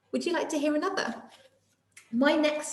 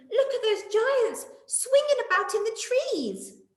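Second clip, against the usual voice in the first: high alarmed voice; scared of the giants; frightened; 12 semitones higher than usual. Acted.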